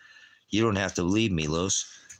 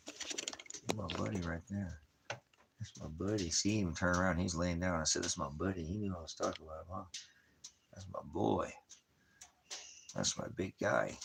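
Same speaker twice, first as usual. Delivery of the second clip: cooing to dog; 7 dB quieter than usual. Real, not acted.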